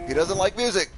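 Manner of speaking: high, loud panicked yell; shrieking he doesn't like music; alarmed shout. Real, not acted. Enemy attacks.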